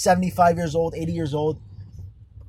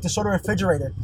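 ordinary delivery

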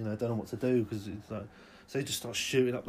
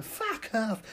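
dismissive scoff; 9 semitones higher than usual. Acted. Tossing burger.

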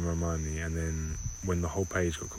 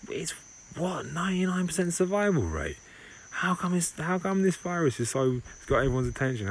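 much higher, squeaky disbelief; incredulous outrage; 11 semitones higher than usual. Acted. Old viruses to each other, shocked and indignant, much higher. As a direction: gasp it out in high indignant disbelief.

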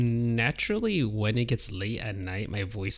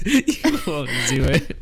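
slow, laughing through the words; 4 semitones higher, 6 dB louder, slower than usual. Pretend. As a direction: giggle it out slowly. Retake while laughing.

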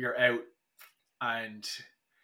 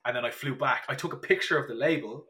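ordinary talk, nothing marked

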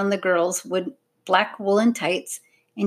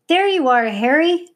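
high, loud call to a dog; raised little girl's call; 8 semitones higher, 4 dB louder than usual. A narrator's reading. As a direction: call out to the runaway pet. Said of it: "called the smaller girl".

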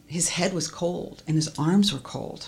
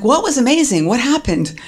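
higher, louder astonished question; startled question; 9 semitones higher, 6 dB louder than usual. Retold or imitated. Wife asks her husband what happened, higher and louder in shock. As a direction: blurt it out in disbelief.

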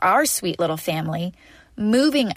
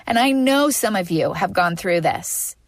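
usual speaking voice

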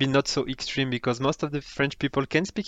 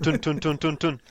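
choppy tun sounds. Shown as a demonstration. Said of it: "point by point".

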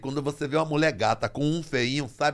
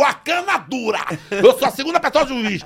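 pompous self-announcement; 9 semitones higher, 8 dB louder than usual. Retold or imitated. Se anuncia como juiz, voz inflada.

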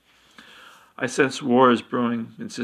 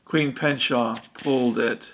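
ordinary delivery

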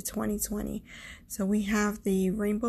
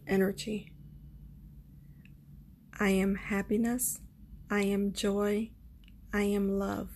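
spoken affirmations; 4 dB quieter than usual. Acted. Leads closing affirmations.

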